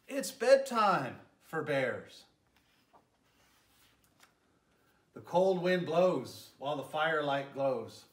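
gentle, lilting read-aloud voice; storybook narration. A narrator's reading. Mayor reading a bedtime story to children, lilting narration. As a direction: tell it like a bedtime story for little ones. Reads picture book to children.

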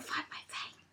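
whispered confession. Real, not acted. Confiding to her friend about the nurses, whispering. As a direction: whisper it like a secret.

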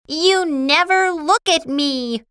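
high, indignant complaint; angry. A narrator's reading. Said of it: "Lucy was angry again".

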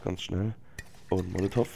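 ordinary delivery

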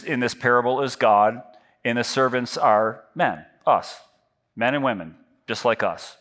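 ordinary delivery

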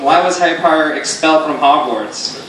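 ordinary talk, nothing marked

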